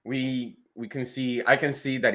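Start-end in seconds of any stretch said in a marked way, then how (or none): none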